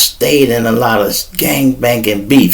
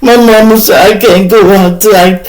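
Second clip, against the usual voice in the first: put-on whiny girlfriend; 5 semitones higher than usual. Girlfriend voiced in story.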